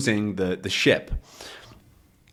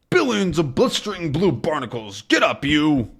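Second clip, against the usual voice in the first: raised put-on voice of a cross captain; angry sea captain; 6 semitones higher than usual. Haddock waking the sleeper, angry. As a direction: order him up in storybook anger. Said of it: "Haddock is now angry".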